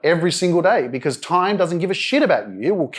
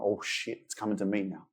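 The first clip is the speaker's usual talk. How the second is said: hushed panicked aside, much softer; panicked inner voice; 8 semitones lower, 14 dB quieter than usual. Retold or imitated. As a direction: mutter it in dread as your turn comes. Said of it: "I'm freaking out".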